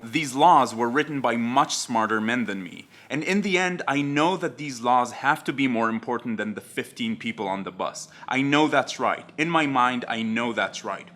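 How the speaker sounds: low, understated dramatic recital; Jack Bauer. Retold or imitated. Jack weighs the laws against saving people, lightly acted, low. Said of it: "He then goes on to talk about the conflict".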